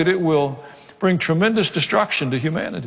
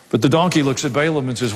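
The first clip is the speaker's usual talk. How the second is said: ordinary delivery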